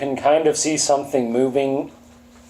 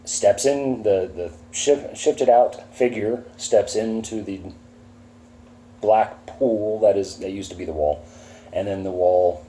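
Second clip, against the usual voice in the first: ominous GM narration; 4 semitones lower, 5 dB quieter than usual. Acted. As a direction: draw the players into the dark. Game master scene setting.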